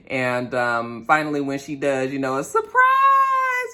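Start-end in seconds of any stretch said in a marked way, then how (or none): 2.4-3.7 hammed-up party surprise, voice leaps up; mock surprise shout. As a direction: sing out "a surprise" much higher, slowly. Acting out the surprise party moment.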